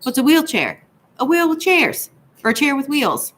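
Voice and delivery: high, bright-eyed joke delivery rising at the punchline; silly joke voice. A narrator's reading. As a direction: pitch it way up like a proud kid telling a groaner. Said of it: "she makes another stupid joke".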